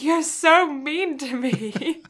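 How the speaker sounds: put-on slow, whiny kid laughing; whiny child. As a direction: whine it like a hurt kid. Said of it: "at the time I was like".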